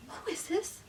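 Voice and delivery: high, soft puzzled question; wary questioning. Retold or imitated. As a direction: ask it small and high. Acting out her cautious reply.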